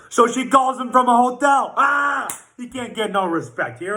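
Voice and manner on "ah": mock yell. Mock sex phone call scene.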